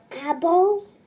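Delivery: ordinary talk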